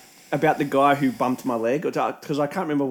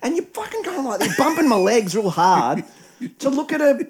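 exasperated; 11 semitones higher than usual. Retold or imitated. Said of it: "I'm like".

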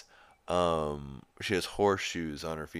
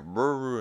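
a loud, high buzzing "Brr"; quick mock buzz noise; mock phone buzz; 6 semitones higher, 6 dB louder than usual. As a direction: imitate a vibrating phone with a sharp high "Brr". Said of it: "And then you just hear".

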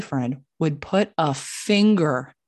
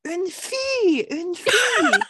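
high, drawn-out mock French whine; exaggerated French accent, high and slow; French guy; 14 semitones higher than usual. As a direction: imitate an outraged Frenchman, pitched way up. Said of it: "this guy, he was like".

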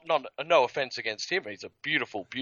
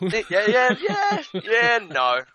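loud, high Aussie "yeah, no"; excited Australian "yeah, no"; Australian accent demo; 11 semitones higher, 6 dB louder, much slower than usual. Shown as a demonstration. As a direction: whoop "yeah" in broad Australian, then "no". Cheering on and modelling the Aussie phrase.

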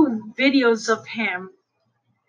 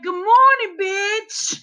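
imitating his greeting; 5 semitones higher, slower than usual. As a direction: belt it out like the funny guy. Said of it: "he be like".

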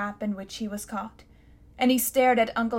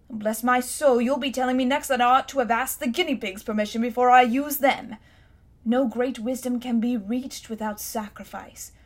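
put-on pompous old uncle; 4 dB louder than usual. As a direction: puff up like an affronted scholar. Uncle voiced in the story.

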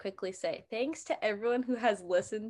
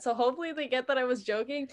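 usual speaking voice

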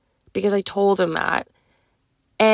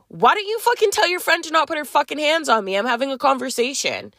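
high-pitched indignant outburst, acted out; retold angry outburst; 7 semitones higher than usual. Telling the friend off after being shoved, retold indignant anger. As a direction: reenact an angry demand in a raised pitch. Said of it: "made me angrier".

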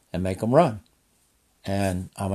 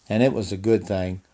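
ordinary talk

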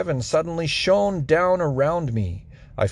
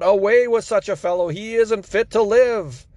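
raised, higher angry crowd cry; crowd shouting; 6 semitones higher than usual. A narrator's reading. Angry crowd rejecting Paul, raised higher shout. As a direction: shout him down as an angry mob. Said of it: "they all began to shout".

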